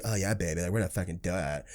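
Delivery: low, soft seductive voice. Retold or imitated. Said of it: "they'll be like".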